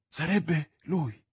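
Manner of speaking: usual speaking voice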